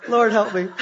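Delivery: ordinary delivery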